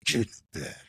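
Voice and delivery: retold stern order in a demon-like tone; lightly voiced scary stepdad; stepdad's menacing tone. Stepdad orders frightened child to bed, stern tone. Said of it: "the way his tone was when he's like".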